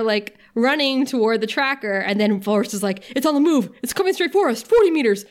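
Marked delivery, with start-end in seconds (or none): 3.1-5.3 raised, higher urgent military callout; mock soldier barking the countdown. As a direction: call the distance closing in. Soldier calling out the tracker.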